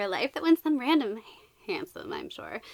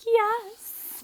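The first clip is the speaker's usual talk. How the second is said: high, louder squealed yes; excited reaction; 10 semitones higher, 5 dB louder than usual. Retold or imitated. Book fan re-enacting her reaction to the title, excited. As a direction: re-enact a burst of delight. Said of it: "I was just like".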